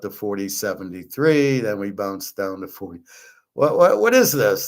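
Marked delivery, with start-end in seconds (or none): 3.5-4.7 indignant disbelief. Real, not acted. Indignant outburst at gold prices.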